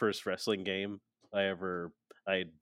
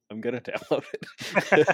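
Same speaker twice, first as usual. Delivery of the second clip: chuckling through the words; laughing; 4 semitones higher, 7 dB louder than usual. Real, not acted. Laughing while joking.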